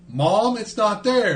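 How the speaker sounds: put-on high, drawn-out kid's complaint; complaining kid. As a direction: imitate a child reporting back to mom, slowly. Voices the child returning empty-handed.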